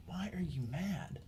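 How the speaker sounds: unvoiced, slow puzzled whisper; whispered confusion. Fighter puzzled by his coach's word, slow whisper. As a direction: ask it under your breath, baffled.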